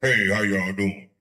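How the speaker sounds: lightly voiced woman faking it deep; mock deep voice. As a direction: imitate someone faking a deep voice. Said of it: "What in the world she trying to talk deep?".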